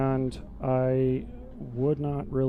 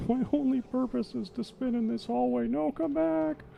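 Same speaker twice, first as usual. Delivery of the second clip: high, goofy creature voice; silly character voice; 12 semitones higher than usual. Pretend. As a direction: voice the spinning monster, dopey and high. Voices a spinning game creature.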